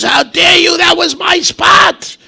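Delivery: high, loud furious yell; enraged woman yelling over a parking spot; furious shout. Retold or imitated. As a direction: scream it at the man who took the spot. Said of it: "She says".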